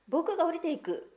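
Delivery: boldly volunteer; eager offer. Acted. Kan-chan volunteering to climb down, eager and loud. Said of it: "とカンちゃんは言いました".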